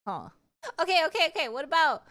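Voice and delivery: ordinary talk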